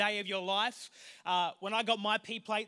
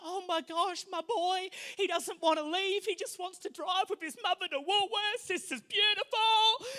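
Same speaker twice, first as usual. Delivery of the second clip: higher, doting mum voice; doting mother; 7 semitones higher than usual. Retold or imitated. Mother gushing over her son on the drive, higher voice. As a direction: gush like a proud mother. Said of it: "She's thinking in her little heart".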